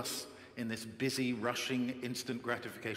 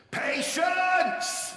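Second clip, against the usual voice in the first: huge bellowed shout, much higher and louder; coach's shout; 10 dB louder than usual. Retold or imitated. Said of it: "He did this by shouting at the top of his lungs".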